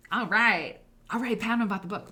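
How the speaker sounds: loud aside to someone in the room; calling out. Real, not acted. Calling to someone off camera about the book, loud. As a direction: call across the room, brisk.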